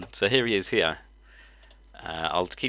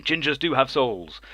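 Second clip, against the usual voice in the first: mock indignant protest, higher and slow; angry ginger kid; 5 semitones higher, slower than usual. Quoting the viral video kid, mock angry. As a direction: lightly imitate a kid's angry outburst. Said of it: "who was getting all angry and going".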